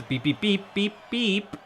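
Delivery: high, even electronic beeping; imitated satellite beeps. Player imitating the launched satellite, high mock beeps. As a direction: mimic a satellite signal by mouth.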